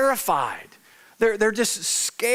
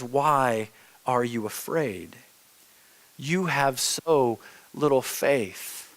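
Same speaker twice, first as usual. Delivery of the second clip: lower, slow, quietly steady; calm low Jesus; 7 semitones lower, 3 dB quieter, slower than usual. Retold or imitated. Jesus quietly calms his frightened disciples in the boat, slow and low. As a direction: gently settle frightened men.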